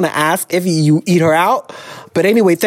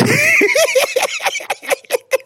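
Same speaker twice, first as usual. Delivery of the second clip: high shrieking laugh. Real, not acted. Laughs at sword line.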